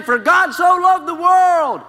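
high, loud, slow proclaiming of the verse; ringing street-preacher cry. Retold or imitated. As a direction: call the verse out like a voice from nowhere. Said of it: "I heard the same thing".

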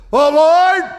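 high, loud cry to God; loud pleading cry of Oh Lord; anguished cry. Acted. Voicing prophet's prayer.

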